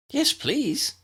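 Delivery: bright eager reply; enthusiastic. Acted. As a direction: jump at the offer. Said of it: "Lydia enthused".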